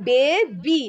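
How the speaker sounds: বে বি with the final Y as "ee"; correct Y as "ee". Shown as a demonstration. Teacher showing pupils the right reading, final Y as "ee". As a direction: end বে বি like English "bee". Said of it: "অর্থাৎ ই উচ্চারণ হচ্ছে".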